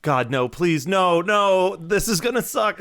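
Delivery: pleading, climbing high and loud; mock emotional breakdown. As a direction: beg in rising hammed-up dread. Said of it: "exact same emotional break".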